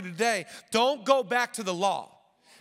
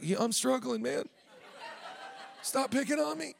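higher, whiny put-on voice for a teasing complaint; mock whiny churchgoer; 4 semitones higher, 6 dB quieter than usual. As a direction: play the hurt guy, half-joking. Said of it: "one guy in our church that's like".

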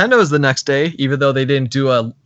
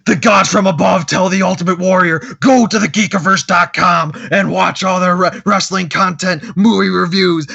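booming wrestler promo, raised and louder; wrestling promo; 4 semitones higher, 4 dB louder than usual. Pretend. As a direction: hype it up like a pro wrestler. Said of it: "reincarnate your favorite wrestling promo".

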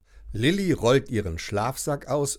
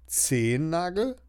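higher, rising doubtful echo; raised, puzzled Zehennagel?; doubting question; 6 semitones higher than usual. A narrator's reading. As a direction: echo the word as a mock question. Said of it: "grün lackierten Zehennagel".

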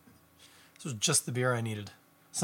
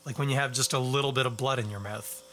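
ordinary talk

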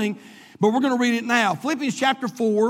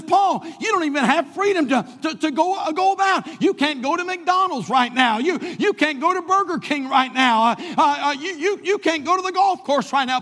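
higher-pitched, stammering protest; incredulous objector; 5 semitones higher than usual. Acted. Someone reminds jailed Paul what he can't do, protesting. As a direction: pile up the objections in a raised pitch.

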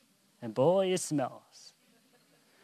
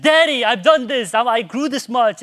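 put-on high, loud kid reporting big news; mimicked child's urgent announcement; imitated boasting child; 9 semitones higher, 11 dB louder than usual. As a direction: play an insistent little boy to his parent. Voices son boasting to his father.

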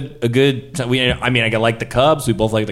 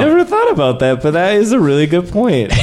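high, loud eager flattery; fawning agreement; 7 semitones higher, 4 dB louder, faster than usual. Acted. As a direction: flatter him with forced enthusiasm. Fawning over killer's remark.

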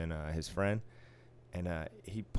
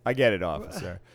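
lower, patronizing mock deference; condescending; 4 semitones lower, 3 dB louder than usual. Worker humouring the boastful trooper, condescending. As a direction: pretend to agree, lightly talking down. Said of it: "calling him officer very condescendingly".

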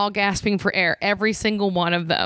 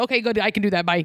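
breezy mock dad; much faster than usual. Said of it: "They're like".

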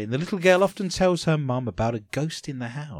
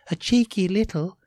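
lightly voiced little Welsh girl; higher, a hint of a small child; young child's voice; 5 semitones higher than usual. Host quotes toddler talking to camera, child voice. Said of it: "that was a young Welsh five-year-old's voice".

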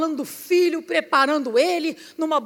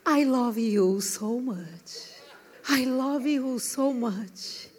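tender love words; 4 semitones lower, 4 dB quieter, much slower than usual. Retold or imitated. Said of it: "A Deve fala mil vezes".

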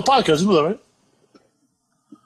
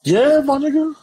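hyped; 11 semitones higher than usual. Real, not acted. Hyped greeting.

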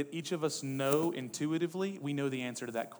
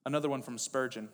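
ordinary talk, nothing marked

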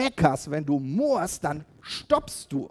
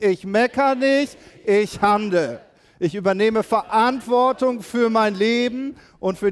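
louder, drawn-out repeat of the slogan; louder and slower; 5 semitones higher, 6 dB louder than usual. Shown as a demonstration. Pastor can't hear them and calls the slogan louder, slower. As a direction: push the crowd to answer louder. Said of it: "Ich höre euch nicht".

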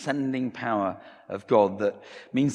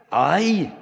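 high, loud Scottish "Aye"; emphatic Scottish pastor's reply; Scottish accent; 9 semitones higher, 8 dB louder than usual. Acted. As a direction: answer firmly in a raised Scottish voice. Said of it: "Sorry for my Scottish accent, guys".

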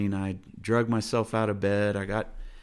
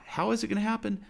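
high, quick fretful question; a hint of frantic worry; anxious pleading; 11 semitones higher, faster than usual. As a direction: mimic a worried believer's panic. Voicing anxious believer's questions.

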